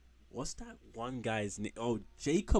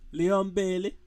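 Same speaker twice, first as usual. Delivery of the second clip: mock Jamaican accent; 7 semitones higher, 8 dB louder than usual. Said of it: "I can't do a good Jamaican accent".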